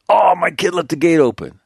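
high-pitched mimicry of a lame excuse; mock excuse. Dog sitter blaming his kid to the owner, mock excuse. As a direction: imitate someone blaming the kid, pitched well up.

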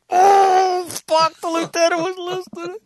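very high-pitched mock shriek; imitated panicked scream. Imitating marines learning the lieutenant heard, hammed-up scream. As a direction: squeal it like guys caught out. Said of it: "heard all these other guys scream".

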